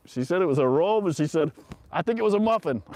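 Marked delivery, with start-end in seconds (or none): 1.9-2.8 high, loud recruit's reply; imitated high-pitched answer to a superior; retold sheepish recruit. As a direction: pipe up to the drill sergeant. Repeats recruit's punchline, amused.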